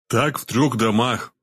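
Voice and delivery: ordinary delivery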